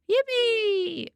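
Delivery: very high gleeful yippee; excited cheer. Real, not acted. Streamer cheering to chat, high excited yippee. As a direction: squeal it with delight.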